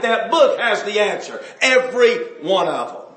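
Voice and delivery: loud, insistent preacher's drive; preaching hard. Acted. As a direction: hammer each point home to the congregation. Preaching emphatically.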